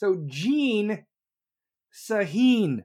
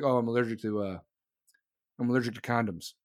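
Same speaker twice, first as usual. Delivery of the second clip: mock guy; 9 semitones lower, 3 dB quieter, faster than usual. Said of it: "if any guy's like".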